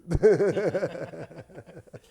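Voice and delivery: long, loud belly laugh; high, rolling burst of laughter; real laughter. Real, not acted. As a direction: crack up loudly at your own joke. Laughs at his own joke.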